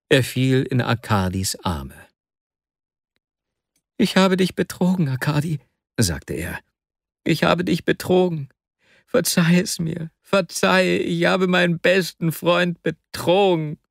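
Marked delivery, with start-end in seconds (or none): 4.0-5.6 tearful confession. Acted. 7.2-13.8 tearful plea. Acted.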